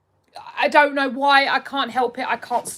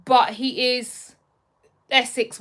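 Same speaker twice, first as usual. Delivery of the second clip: ordinary talk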